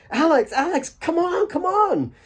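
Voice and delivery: high, eager calling out; a boy bursting to drag his friend off; excited boy. Acted. Asif running up, calling Alex to the game excitedly. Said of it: "poppy-eyed with excitement".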